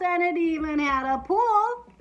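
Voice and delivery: high, loud burst of delight; thrilled climax. Acted. Boy raving about the greatest book ever, thrilled climax. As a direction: cap the list with an excited cry.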